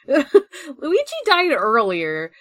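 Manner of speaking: laughing through the words; real laughter breaking into the line. Real, not acted. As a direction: crack up as you say it. Laughing while recalling Luigi's crash.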